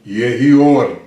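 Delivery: a hint of a booming divine voice; voice of God. Shown as a demonstration. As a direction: speak it lightly as God commanding light. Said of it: "a voz de Deus".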